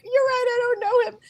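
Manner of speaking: very high, weepy agreement; imitating herself in tears; mock sobbing. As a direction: give in with a high, tearful squeak. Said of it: "I'm sobbing".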